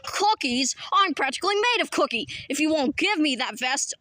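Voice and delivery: usual speaking voice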